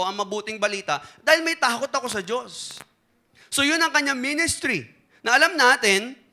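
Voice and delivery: firm pulpit preaching. Acted. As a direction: preach it to the congregation. Preaching the sermon point.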